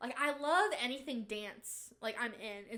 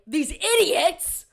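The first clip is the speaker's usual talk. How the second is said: slow, much louder, spat out; mock anger; 8 semitones higher, 11 dB louder, slower than usual. Ranting to viewers with hammed-up anger. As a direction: drag out the insult in hammed-up rage. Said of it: "hear me get angry".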